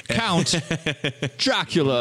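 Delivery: high, put-on Walken voice for Count Dracula; Walken impression. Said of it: "Christopher Walken".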